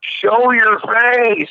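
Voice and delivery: high, slow drawn-out crowd chant; mock crowd demand. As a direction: imitate women demanding it, stretching each word. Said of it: "Women were like".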